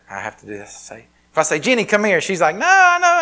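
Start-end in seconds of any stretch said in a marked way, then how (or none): 1.6-2.2 high, loud call across the house. Retold or imitated. Father calling his little girl from across the room, loud call. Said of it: "But if I yell at her". 2.6-3.2 put-on high, loud squeal of a little girl; child refusing. Daughter running from her father's kisses, squealing no.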